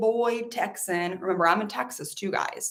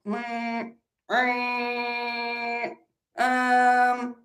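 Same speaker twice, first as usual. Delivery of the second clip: doubtful hum; 2 semitones higher, much slower than usual. Acted. Customer hesitating.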